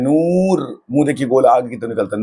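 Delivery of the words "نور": نور stretched, higher, lips forward; drawn-out noor. Shown as a demonstration. Teacher showing students a stretched نور. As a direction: pull the oo in نور long and round. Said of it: "کھینچ کے پڑھتے ہیں".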